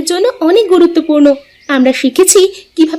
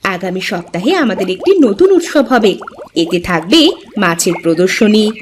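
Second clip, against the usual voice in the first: a lightly deeper voice announcing the festival; lower character voice; 6 semitones lower than usual. Acted. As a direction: drop into a lower second character. (নিচু গলায় চরিত্রের ঘোষণা)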